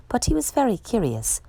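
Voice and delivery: ordinary delivery, nothing marked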